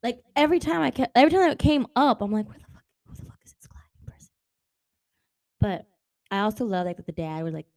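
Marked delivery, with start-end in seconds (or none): 2.5-4.3 hushed, disbelieving aside to yourself; mock-confused whisper; incredulous whisper. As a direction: whisper it, baffled and a little rude. Confused reader's inner thought.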